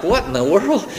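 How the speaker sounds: mild mock astonishment at the outburst; taken aback. Startled visitor sitting in the service, retold bewilderment. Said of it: "and I was like".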